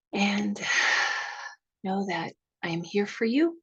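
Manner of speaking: gentle guided relaxation opening a workshop; guided meditation. Acted. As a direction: breathe them into calm, drifting into whispers.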